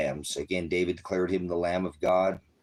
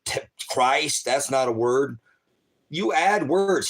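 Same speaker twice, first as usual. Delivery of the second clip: raised, higher, louder protest; retelling the arrest, replaying his own loud objection; retold indignant protest; 6 semitones higher, 5 dB louder than usual. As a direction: mimic the indignant arguing back. Retold quarrel with arresting officer.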